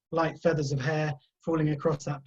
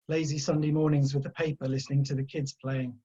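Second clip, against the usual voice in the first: usual speaking voice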